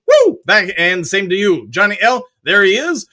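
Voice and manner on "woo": cheer. Real, not acted. Cheering after a viewer's greeting.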